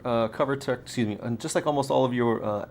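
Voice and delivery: ordinary talk, nothing marked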